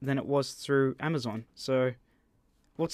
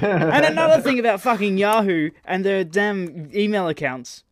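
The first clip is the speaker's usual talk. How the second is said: loud, higher mock rant; 5 semitones higher, 6 dB louder than usual. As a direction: burst into a hammed-up rant. Sudden rant outburst.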